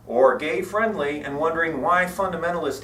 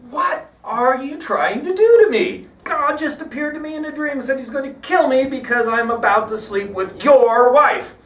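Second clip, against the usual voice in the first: 1 miffed; 8 semitones higher, 4 dB louder than usual. Retold or imitated. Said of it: "quite miffed".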